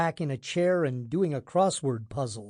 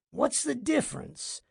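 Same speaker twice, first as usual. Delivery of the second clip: higher, softer sighing question; sighed; 9 semitones higher, 3 dB quieter than usual. A narrator's reading. Boss dismissing his assistant's correction, sighed. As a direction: let it out as a weary sigh. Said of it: "sighed Edelman".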